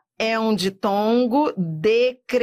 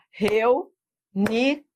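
REUNIÕES in three spaced beats; syllable by syllable. Shown as a demonstration. Teacher counting syllables like handclaps, syllable by syllable. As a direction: split reuniões into reu, ni, ões. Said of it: "Cuidado na hora de separar as sílabas".

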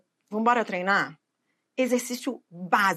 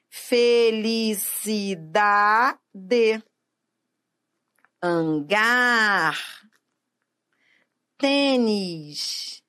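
long VÓL in "Revólver", MI in "Amigo", DA in "Felicidade", GAR in "Hangar", TÊ in "Tênis", ÓR in "Órgão"; slow, sustained stress on "Revólver", "Amigo", "Felicidade", "Hangar", "Tênis", "Órgão"; exaggerated stressed syllable; much slower than usual. Shown as a demonstration. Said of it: "isso é uma questão de pronúncia".

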